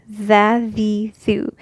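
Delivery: tha, the, thu with tongue-out th; tha, the, thu clearly articulated; Arabic letter vowels. Shown as a demonstration. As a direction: run the three vowel forms.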